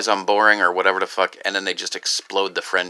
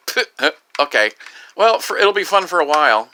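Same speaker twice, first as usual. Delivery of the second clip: higher, louder mock going-along; dismissive scoff; 6 semitones higher, 5 dB louder than usual. Cynic reacting to the word friend, mock-dismissive. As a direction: puff it off, then play along loudly.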